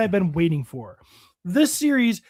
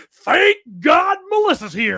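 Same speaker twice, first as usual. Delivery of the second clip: high, loud, slow mock relief; hammed-up cry of thanks to a rescuer; relieved rescued men; 7 semitones higher, 5 dB louder, slower than usual. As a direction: cry it out like a mock-grateful damsel. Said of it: "they're gonna be like".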